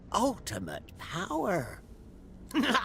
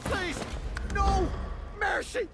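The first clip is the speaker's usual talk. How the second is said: pleading; 8 semitones higher than usual. Acted. Undead begging for mercy.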